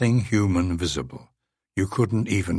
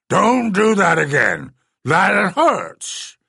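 pained creature voice; 11 semitones higher, 6 dB louder, slower than usual. A narrator's reading. Beast hurt, complaining.

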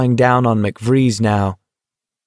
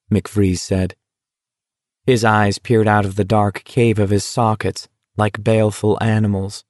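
ordinary delivery, nothing marked

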